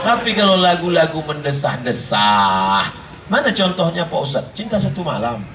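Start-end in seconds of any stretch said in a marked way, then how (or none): 1.2-2.9 low, drawn-out mock seductive; mock sighing sultriness. Shown as a demonstration. Preacher mocking seductive sighing songs, low drawn-out delivery. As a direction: let the word sink into a long sigh.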